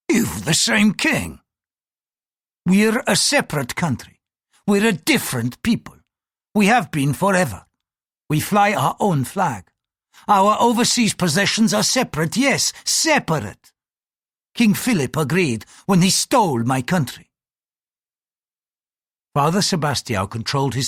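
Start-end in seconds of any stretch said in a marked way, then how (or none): 0.1-1.4 slow, goading jab; taunting. A narrator's reading. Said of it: "Blackthorne said, taunting". 2.7-17.3 high-pitched, heated protest; offended patriot, emphatic; indignant priest. Acted.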